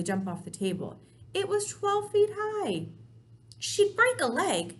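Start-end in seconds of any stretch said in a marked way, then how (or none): 1.3-4.8 high worried voice. A narrator's reading. Sophie's worried thoughts voiced.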